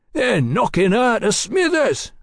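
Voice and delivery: high-pitched cheerful crowing; cheerful declaring. A narrator's reading. Prisoner relishing the wrecking of the carriage, cheerful. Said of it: "Tally declared cheerfully".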